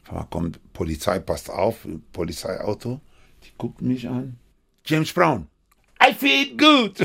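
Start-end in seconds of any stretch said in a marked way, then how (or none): 4.8-7.0 high, loud [name] yelp; [name] shout. Retold or imitated. Policeman calls out famous line.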